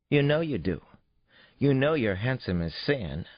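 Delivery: put-on high, eager child's voice; pry like a nosy kid; curious little girl. A narrator's reading. Daughter quizzes her dad about his date, eager and curious.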